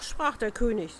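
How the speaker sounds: usual speaking voice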